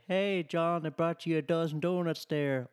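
mock coworker. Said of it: "You say".

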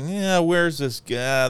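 slow, high, drawn-out grumble; impatient inner voice. Retold or imitated. Viewer mocking their own early impatience, slow and high. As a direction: stretch it out, bored and annoyed.

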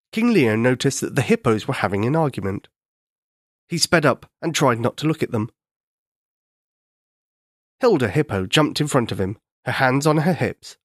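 usual speaking voice

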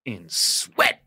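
loud, heavily aspirated dramatic punch; climax of Fame monologue. Retold or imitated. Finishing the recital for the table, emphatic and theatrical. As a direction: land it hard after a long pause. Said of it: "Long pause".

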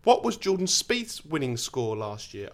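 normal talking voice

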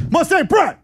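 high shouted Mustang Pride; yelled slogan. Retold or imitated. Player yelling the slogan at the door, high shout. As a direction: belt out "Pride" like a chant. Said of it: "you're gonna yell".

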